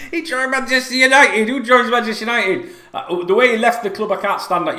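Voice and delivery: loud, high, exasperated outburst; indignant rant. Real, not acted. Fan ranting to viewers about Owen, loud indignant outburst. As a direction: vent at full volume about the traitor.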